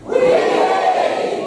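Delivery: high, loud crowd shout of oui oui; big shouted oui oui. Shown as a demonstration.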